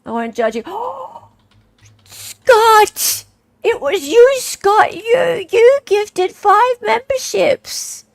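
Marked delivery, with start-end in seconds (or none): 0.7-1.2 high gasp of surprise; surprised gasp. Real, not acted. Streamer sees a viewer's gift arrive, surprised gasp. Spots Scott's gifted memberships. 2.0-8.1 high, loud squeal of delight; thrilled surprise. Real, not acted. Streamer thanks Scott for five gifted memberships, thrilled surprise.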